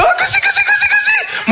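very high squealing giggle; tickled father giggle. Retold or imitated. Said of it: "Tu lui fais". (le père chatouillé glousse, très aigu)

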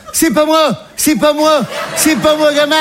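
high, flustered denial; mock flustered mum, high; guilty mother. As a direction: protest innocence like the mum. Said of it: "elle était là".

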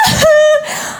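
high, self-satisfied mum's laugh; affected laugh. Acted. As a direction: laugh high after your own boast. Bragging mother's affected laugh.

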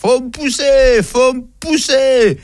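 put-on high, drawn-out drunken yell. Drunk man under a window at night, high drawn-out yelling. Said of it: "il hurle comme ça".

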